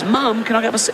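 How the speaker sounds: high, childish mock voice of a newborn; baby giraffe. Newborn giraffe asks mum.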